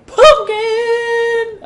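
very high, loud squealed exclamation; voice cracking high. Real, not acted. Gamer hailing the pumpkin pie, cracked high shout. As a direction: shout it until the voice cracks upward. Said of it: "my voice cracked so high there".

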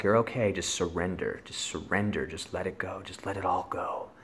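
soft, low calm reassurance; quiet voice telling him to surrender; soothing inner voice. Retold or imitated. Higher power speaking to him.

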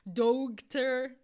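"Doctor" drawn out with a hard g, DOUG-ter; wrong spelling-based "Doctor", g and t both sounded; mispronounced daughter. Shown as a demonstration. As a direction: loudly overdo the spelled gh in "Doctor". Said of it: "Não é doctor".

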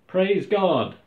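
high, loud shout of praise. A narrator's reading. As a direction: cry it out like one of a cheering crowd. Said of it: "who were shouting".

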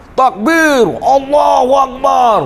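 loud, high call of Allahu Akbar; takbir shout. Retold or imitated. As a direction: shout the takbir like rallying the team. Team praying before the match.

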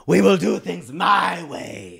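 slow, loud imitation of a demanding girlfriend; mock scream. Girlfriend insisting on her way, mock scream. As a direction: belt it out slowly as a mock demand. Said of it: "she wants to scream".

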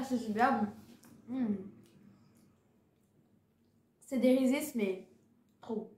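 speaking between bites; talking while chewing. Real, not acted. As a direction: mouth partly full of candy. Seen 3: holds candy, bites and chews between phrases during labelled words.

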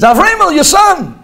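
loud high call to his father. Retold or imitated. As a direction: shout to get Dad's attention. Son calling to father.

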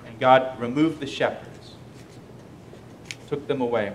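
ordinary delivery

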